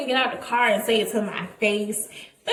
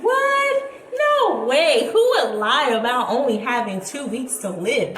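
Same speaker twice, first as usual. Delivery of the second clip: shocked disbelief; 3 dB louder than usual. Retold or imitated. Friends react in disbelief.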